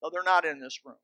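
ordinary talk

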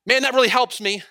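shouted high and loud across a distance; imitating a man yelling from afar; retold yell. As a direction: call it out across the park. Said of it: "He's yelling across the park".

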